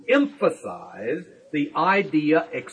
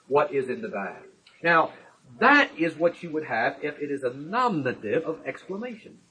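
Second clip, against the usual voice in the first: usual speaking voice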